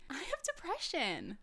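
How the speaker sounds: high, slow, pointed mock indignation; indignant retort. Retelling her reply to the boy who called her sad, high and slow. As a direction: land it high and slow, like it's obvious.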